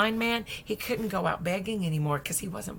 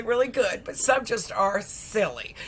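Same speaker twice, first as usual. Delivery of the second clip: ordinary talk, nothing marked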